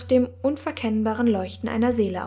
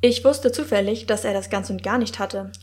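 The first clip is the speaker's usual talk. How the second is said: plain reading voice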